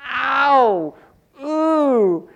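loud mock cries of pain getting out of bed; pained groans. Preacher acting out his sore morning, loud pained groans. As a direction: groan like every joint aches.

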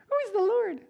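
very high, squeaky and comic; silly squeaky voice. Retold or imitated. Preacher mocking how Pharaoh surely didn't sound. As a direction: squeak it like a cartoon. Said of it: "All his subjects would be like".

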